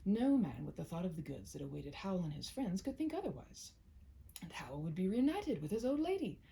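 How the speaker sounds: plain reading voice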